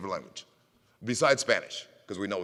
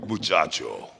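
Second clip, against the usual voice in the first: character catchphrase; 7 semitones lower than usual. Pretend. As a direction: say it low like a stern hero. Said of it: "your variation of boy in different languages".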